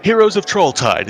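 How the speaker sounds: loud, high stage announcement; announcer call. Acted. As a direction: call out to the room like a showman.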